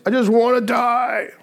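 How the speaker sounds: high, slow whining wish to die; whiny Jonah. Retold or imitated. Preacher voicing sulking Jonah, high whiny plea. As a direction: whine it like a sulking prophet.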